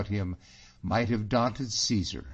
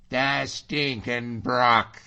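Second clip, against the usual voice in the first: slow, louder outburst of disgust; indignant curse; 4 semitones higher, 6 dB louder, much slower than usual. Acted. Allan on reading the letter, indignant drawn-out curse. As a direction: draw the insult out, higher and loud.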